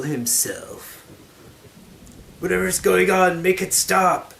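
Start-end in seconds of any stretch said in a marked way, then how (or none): 2.4-4.3 high, loud, pleading voice; hammy stage actor. Acted. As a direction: beg loudly in a high voice. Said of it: "he begged".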